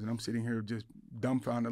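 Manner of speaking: usual speaking voice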